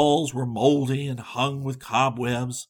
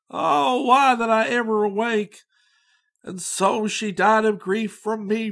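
anguished lament; 6 semitones higher than usual. A narrator's reading. Old Conrad mourns his lost Katharina, anguished lament. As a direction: mourn her as if your heart breaks.

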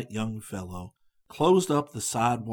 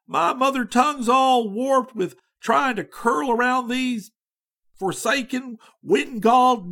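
high, loud, rattling Yankee chatterbox; homesick American student; 8 semitones higher, 6 dB louder than usual. A narrator's reading. Charlie Adams rattles on to the Reverend, gushing and excited. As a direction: let the lonely student gush without pause.

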